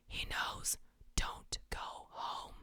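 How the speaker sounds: hushed creepy whisper into the mic. Pretend. Streamer reading a creepy chat line, whispered. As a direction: breathe it like a stalker in your ear. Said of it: "whispering something creepy".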